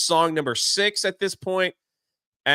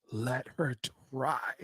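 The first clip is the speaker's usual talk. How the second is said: lower, much softer and slow; soft Dopey line; 5 semitones lower, 11 dB quieter, slower than usual. Retold or imitated. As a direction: barely say it, low and timid. Silent Dopey finally speaks.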